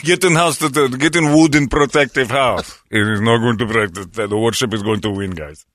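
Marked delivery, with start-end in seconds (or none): 0.0-2.8 higher mock Russian accent. Host echoing the warship order, mock Russian accent. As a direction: order them inside like a Russian officer.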